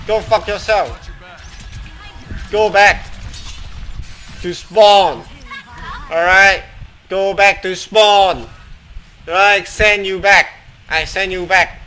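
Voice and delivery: loud, taunting yell to go back to spawn; shouting taunt. Real, not acted. Gamer yelling at the enemy to retreat, loud taunt. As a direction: bark it at the enemy like a dare.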